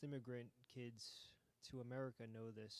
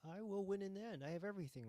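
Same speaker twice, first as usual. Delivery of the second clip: loud, high triumphant declaration; 7 semitones higher, 5 dB louder, faster than usual. Acted. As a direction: proclaim victory like a winner. Reading the game's bold choice.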